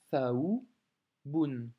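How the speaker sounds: thaoubun drawn out syllable by syllable; slow syllable reading. Shown as a demonstration. Teacher reading a word aloud for a learner, slowly. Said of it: "donc tha ou".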